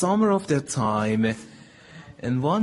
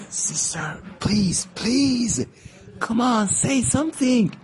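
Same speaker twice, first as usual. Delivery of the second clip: higher, begging please, please; pleading little brother; 10 semitones higher than usual. Retold or imitated. Boy begs sister to defend him, higher pleading voice. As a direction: beg your sister to speak up for you.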